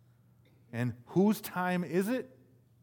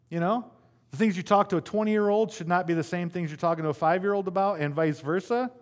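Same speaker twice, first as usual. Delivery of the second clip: ordinary talk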